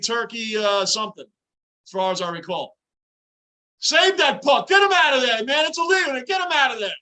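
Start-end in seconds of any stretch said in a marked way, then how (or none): 3.8-6.9 high, loud yelling at the players; desperate shouts from the basement; urgent shouting. Real, not acted. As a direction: bellow at the team to clear it. Urgent cheering during scramble.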